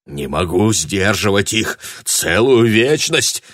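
strained breathless sorcerer. A narrator's reading. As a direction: gasp it out. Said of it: "выдохнул Бакор".

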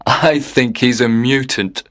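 half-joking, with a laugh before it; amused. A narrator's reading. Father jokes to his wife about their son, amused. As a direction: tease with a chuckle. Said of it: "and he laughed".

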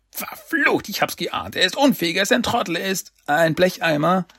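high-pitched villain fury; wütend. Acted. Krang rails at his bungling underling, furious and high-pitched. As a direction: curse in an angry, high character voice. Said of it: "Dann Cranks wütende Stimme".

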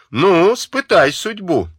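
defiant challenge. A narrator's reading. Dunya aims a revolver.

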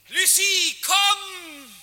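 high ringing shout; calling out. Acted. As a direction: call her across the distance, loud and high. Calls her to come.

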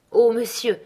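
exclamation. Acted. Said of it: "m'écriai-je".

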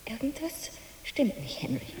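slow, fearful whisper; uneasy whisper. Acted. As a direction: whisper the dread slowly. Frau flüstert beunruhigt. (langsames, beklommenes Flüstern)

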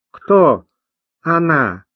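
с подозрением, протяжно; не веря ни слову; недоверчиво протянуть. A narrator's reading. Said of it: "недоверчиво протянул". (недоверчивый вопрос)

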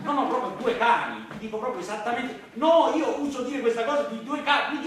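normal talking voice

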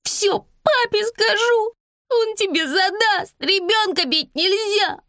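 put-on very high, drawn-out child's wail; high-pitched indignant childish tantrum; wailing little girl. A narrator's reading. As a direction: howl threats like a slapped kid. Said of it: "громкий рёв".

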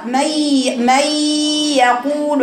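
"مي يقول" drawn out with a long nasal hum; slow ghunna drill. Shown as a demonstration. Teacher showing how to bring ghunna into "مي يقول". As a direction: stretch "مي" slowly through the nose. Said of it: "يبقى إزاي أقرأ".

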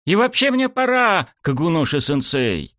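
very high-pitched protest, easing at the end; yelling. A narrator's reading. Student escaping coach, high-pitched yelling. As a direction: yell as you try to get away. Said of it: "заорал я".

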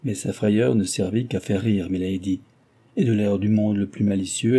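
usual speaking voice

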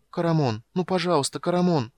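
high, imploring voice; coaxing, high-pitched entreaty; tender pleading. A narrator's reading. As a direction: beg him gently but urgently. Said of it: "просящий, ласковый, нежный".

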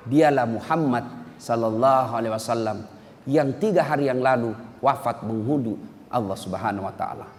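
low, soft, grieving announcement; hushed grief. Acted. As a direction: let the news fall quietly and sadly. Telling of the Prophet's death. (suara rendah dan sedih)

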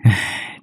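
long weary exhale; sigh. Acted. Two roommates look at each other, sigh. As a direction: let out an audible sigh. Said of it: "Hai đứa nó nhìn nhau thở dài".

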